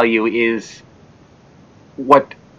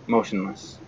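quiet; 5 semitones lower, 12 dB quieter than usual. A narrator's reading. Grim narration ending.

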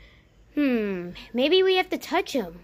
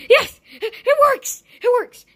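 excited cheer; 11 semitones higher, 9 dB louder, slower than usual. Acted. Flashlight finally turns on.

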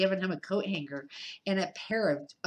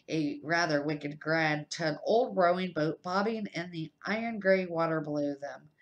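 ordinary delivery, nothing marked

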